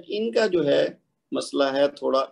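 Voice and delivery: normal talking voice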